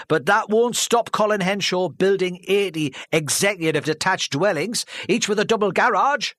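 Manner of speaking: mock the planning wording; sneering. A heckler sneering at the developer's wording, sneering. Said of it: "He sneered".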